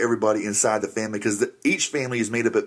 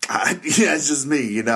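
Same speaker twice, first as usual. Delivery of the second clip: ordinary talk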